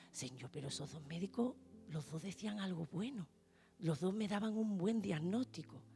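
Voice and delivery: más grave y muy suave; oración susurrada. Retold or imitated. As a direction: habla con Dios en voz baja. Reza conduciendo al trabajo. (rezando sola en el coche, muy bajito)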